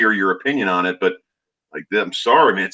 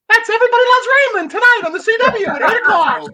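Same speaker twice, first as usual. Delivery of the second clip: very high, fast mock announcer voiceover; [name]'s high-pitched impression; 4 dB louder, faster than usual. Co-host mimicking announcer's TV promo, high-pitched mock voiceover. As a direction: push way up high and rattle off the promo. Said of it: "a little more high-pitched than your normal voice".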